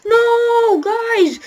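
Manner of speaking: high, loud cry of no; dismayed shout. Real, not acted. Gamer protesting to rival racers, loud dismayed shout. Losing place in race.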